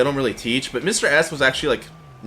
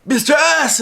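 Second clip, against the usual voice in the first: excited greeting; 12 semitones higher, 4 dB louder, slower than usual. Retold or imitated. Spotting teacher at fashion show.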